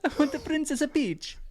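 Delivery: high mock-Italian cartoon voice; Mario impression. Mario demanding his princess, high cartoon voice.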